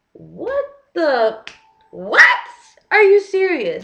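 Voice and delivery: high, loud disbelieving outburst; shocked disbelief. Real, not acted. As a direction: blurt it out in sheer disbelief. Reacting to fake merchandise.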